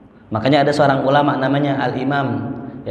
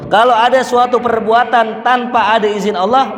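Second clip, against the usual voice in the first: fervent preaching; 10 semitones higher, 5 dB louder than usual. Real, not acted. Preacher building to climax.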